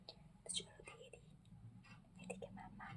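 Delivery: hushed whisper so the family sleeps on. Real, not acted. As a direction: breathe the words softly late at night. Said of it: "আস্তে আস্তে কথা বলছি".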